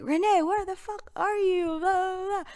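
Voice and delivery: normal talking voice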